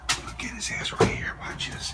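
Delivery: hushed sneaky whisper; giggly prank whisper. Real, not acted. Sneaking up to scare friend.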